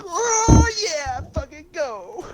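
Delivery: very high drawn-out mock celebration; hammed-up high-pitched whoop; imitated fan cheer. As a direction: explode like an obnoxious fan. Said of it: "And then the score goes".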